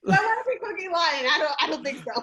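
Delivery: laughing, high and loud mock protest; amused protest. Real, not acted. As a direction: protest through laughter, pitch jumping up. Laughs off a friend's teasing.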